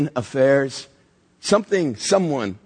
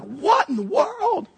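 much higher, very slow, drawn-out disbelief; stunned onlooker can't believe his eyes; baffled; much slower than usual. Acted. A shopper watches a stranger get punched, slow high disbelief. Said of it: "you're like".

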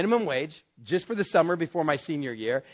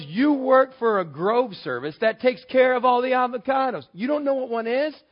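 incredulous scolding; 7 semitones higher, 4 dB louder than usual. Retold or imitated. Jim scolding the ignorant kid.

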